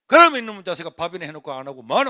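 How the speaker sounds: mother's scolding. Retold or imitated.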